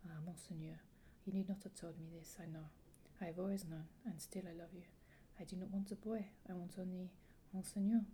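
lightly acted French-accented heroine. Young ward answers her guardian's confession, French accent. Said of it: "I really enjoyed your French accent".

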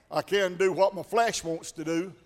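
usual speaking voice

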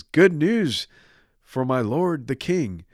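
high, loud messenger's proclamation; heralding good news. Acted. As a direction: announce it grandly to the king. Messenger announces victory to king.

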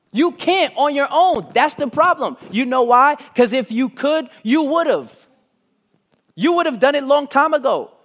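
loud, high-pitched preaching; full-force preaching. Real, not acted. Preacher telling the congregation God isn't waiting, full-force preaching. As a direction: drive every phrase home at full force.

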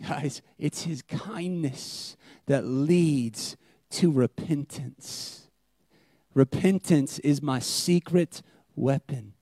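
slow, soft sermon emphasis; hushed slow proclamation; intimate preaching. Acted. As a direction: lean in and let it land.

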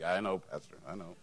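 low, soft mumbled agreement; guys in congregation. Retold or imitated. Husbands in the pews answering their pastor, low and subdued. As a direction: imitate men giving in, pitched low and quiet. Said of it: "Guys are like".

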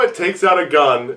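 usual speaking voice

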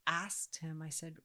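normal talking voice

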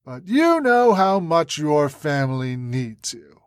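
slow, drawn-out taunt; sneering. A narrator's reading. Terrorist leader threatening the senator's family, slow sneer. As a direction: drag it out as a veiled threat. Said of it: "Mick sneered".

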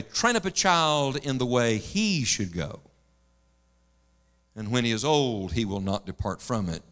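low, measured verse with HE picked out; stress on HE. Retold or imitated. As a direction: quote it low and unhurried, weight on "he". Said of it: "said it this way".

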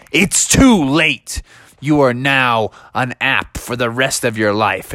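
slow, drawn-out pronouncement of doom; acted villain voice, slow at the start; ominous weatherman. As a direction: stretch it out like a story villain.

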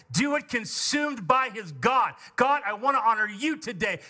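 high-pitched, driving sermon delivery; fervent preaching. Acted. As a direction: preach it with mounting fervour into impassioned prayer. Preaches at full force.

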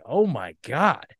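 drawn-out oh my God, slow; stunned horror, slowly; slow horrified gasp. Retold or imitated. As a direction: let it sink in with dread. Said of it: "And I was like".

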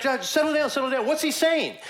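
high, loud hurried hushing; flustered Saul. Retold or imitated. Saul calms the panicking witch in a high, rushed voice. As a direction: hush her quickly, he's rattled too.

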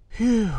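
high, loud sigh of relief; relieved phew. A narrator's reading. The guard, after the hatch seals, a relieved phew. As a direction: let the tension out in one relieved breath. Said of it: "let loose with a relieved".

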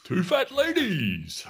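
loud, high, slow call-out; bingo call. Acted. Co-host jumping ahead to eighty-eight, bingo call. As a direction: belt it out to the whole hall.

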